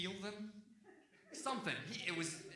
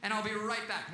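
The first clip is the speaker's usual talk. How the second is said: louder, higher mock yell; yelled promise; 4 semitones higher, 6 dB louder than usual. Owner leaving his dog behind, mock yelling. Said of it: "So I yell at my dead dog".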